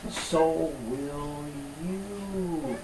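very slow, stretched final words; drawn-out promise. Acted. Pastor promising the congregation resurrection, very slowly drawn out. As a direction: let each word hang as a promise.

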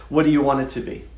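ordinary talk, nothing marked

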